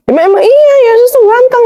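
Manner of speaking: high-pitched retold insistence; insistent retort. Guest retells defending his picture of Jesus to opponent, insistent. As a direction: insist back, voice jumping up high.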